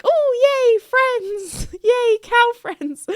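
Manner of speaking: high-pitched, gleeful cheer; excited squeal. Retold or imitated. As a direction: squeal with delight at new cow friends. Retold delight at friendly cows.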